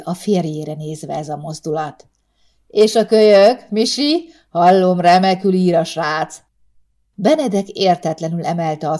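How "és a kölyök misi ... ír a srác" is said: loud, hearty tipsy teasing; jovial drunk boss. Acted. Tipsy boss ribbing his worker loudly. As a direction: boom it out like a boozy boss.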